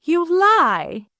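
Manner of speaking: furious repeat. A narrator's reading. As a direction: hit lie harder the second time. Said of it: "eyes blazing with wrath".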